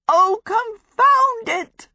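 very high, loud and slow exasperation; exasperated cry. Acted. Sophie overshoots again to Marsh Folding, high slow loud exasperated cry. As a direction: wail it out as you land wrong again. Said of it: "Sophie cried".

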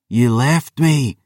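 slow, high, bitter accusation; hissed. Acted. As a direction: spit it at the father who betrayed her. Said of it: "Chiara hissed".

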